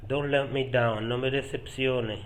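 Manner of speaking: normal talking voice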